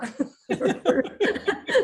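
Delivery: bright burst of laughter at herself; a quick high laugh; real laugh. Real, not acted. Laughs at own accent.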